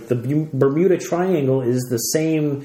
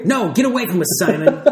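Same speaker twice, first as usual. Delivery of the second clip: higher-pitched mock dismissal; mock brush-off; 5 semitones higher than usual. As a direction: act out shooing a pest away. Acting out kids rebuffing Simon.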